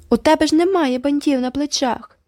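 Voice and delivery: high squeaky mocking echo; squeaky mimicry. A narrator's reading. Girl mocking a rival, squeaky high echo. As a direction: squeak it back to mock her. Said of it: "пропищала Джеральдіна".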